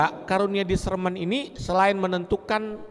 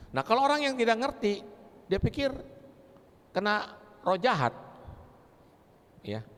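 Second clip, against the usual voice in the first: normal talking voice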